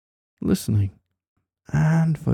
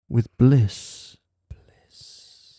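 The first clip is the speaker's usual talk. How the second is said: breathy whispered repetition; much slower than usual. Acted. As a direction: whisper it into the ear. Whispered word in hypnosis.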